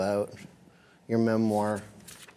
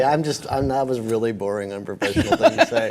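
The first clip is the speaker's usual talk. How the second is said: normal talking voice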